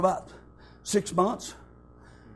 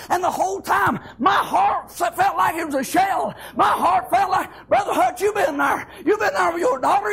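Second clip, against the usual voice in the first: higher, shouting, voice cracking near the peak; preaching at full force; 5 semitones higher than usual. Acted. Preacher telling the church how God carried him, in full-force preaching. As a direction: climb to a tearful shout of praise.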